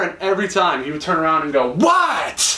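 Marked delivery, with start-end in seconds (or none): 1.7-2.5 high, mock-irritated what; joking fake annoyance. Outfielder turning to pestering fans, mock-annoyed. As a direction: pretend to be bothered, all in fun. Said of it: "jokingly sound mad".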